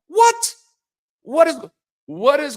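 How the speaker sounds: high and loud, startled; shocked what. Real, not acted. To the crowd, shocked loud high what. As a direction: yelp it in disbelief.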